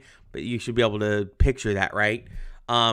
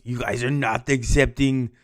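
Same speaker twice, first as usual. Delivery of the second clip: put-on a second try at the Russian accent, a bit fuller; lightly hammed Russian accent. Teacher redoing Stalin for the class in a mock Russian accent. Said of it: "It's my best Russian voice".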